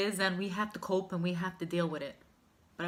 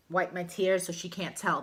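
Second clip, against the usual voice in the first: usual speaking voice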